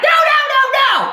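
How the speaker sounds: high wailing string of refusals; high-pitched protest. Retold or imitated. Mother in labor refusing to go, high-pitched protest. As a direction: wail it high in mock panic.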